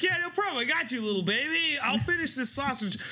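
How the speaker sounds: high cheery dad voice; sweet high mock self. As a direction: play it oblivious and sweet. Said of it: "And I was like".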